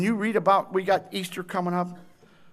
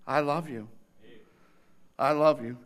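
tender; 6 semitones lower than usual. Acted. Christ's tender words after shouting.

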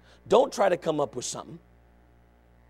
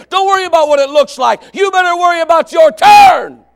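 building preacher shout; 7 semitones higher, 12 dB louder than usual. Real, not acted. Preacher urging the altar call, building to a shout. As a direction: start firm, climb to a loud high shout.

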